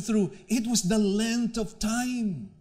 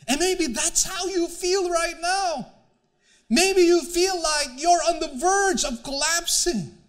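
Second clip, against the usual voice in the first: preaching; 6 semitones higher than usual. Acted. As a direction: press it home to the congregation. Preacher rises toward the congregation.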